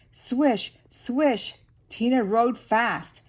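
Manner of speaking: plain reading voice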